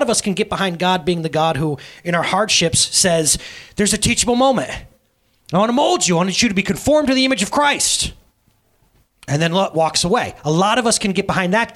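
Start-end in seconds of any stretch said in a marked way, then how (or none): 3.8-8.1 raised, declaiming voice of a distant God; mock pronouncement, pitched up; lecturing God. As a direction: proclaim it grandly from on high.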